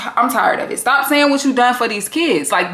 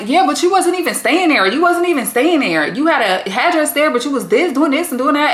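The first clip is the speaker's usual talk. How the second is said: higher-pitched, insistent retold objection; Trisha butting in; 6 semitones higher than usual. Reviewer acting out her cutting into his account, insistent and high. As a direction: jump in arguing, voice lifted all through. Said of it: "Trisha jumps in like".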